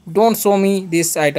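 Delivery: very slow, each word on its own; slow model sentence. Shown as a demonstration. Teacher dictating an example to students, very slow. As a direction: spell the phrase out slowly for the class. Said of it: "example sentence".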